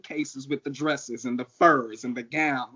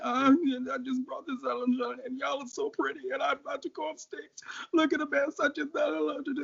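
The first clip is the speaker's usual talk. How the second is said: high, whiny imitation of her own sobbing self; mock blubbering; 11 semitones higher than usual. Drag queen retelling her sobbing to a kind queen, high blubbering voice. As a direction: pitch it way up like a crying girl. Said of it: "So I'm blubbering, trying to tell her".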